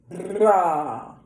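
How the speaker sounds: trilled br into an open ah; rolled brah demo. Shown as a demonstration. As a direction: roll the r then open to ah. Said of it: "but now we're gonna go".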